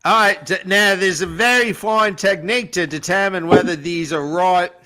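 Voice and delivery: put-on broad Aussie with rising tag questions; Bondi Australian accent. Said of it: "Bondi B".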